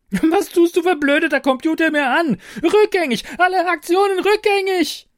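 very high, loud raging shriek; hysterical screaming. Acted. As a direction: scream at the computer in despair. Brigitte screams at computer.

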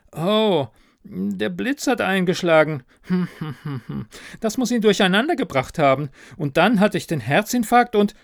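high, rambling ghost voice with a chuckle; flustered ghost manager. Acted. Dead manager explains lightning strike to narrator, high and flustered.

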